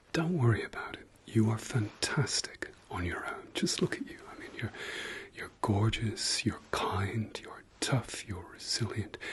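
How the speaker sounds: usual speaking voice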